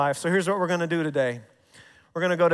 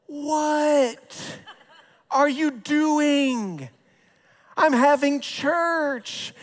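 surprised; 7 semitones higher, much slower than usual. Retold or imitated. Dad's slow startled reply.

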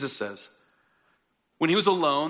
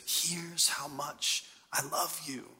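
slow, soft and tender; tender father; 8 dB quieter, much slower than usual. Retold or imitated. Father tells his children how much he loves them, slow and tender. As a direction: say it gently, like tucking a child in.

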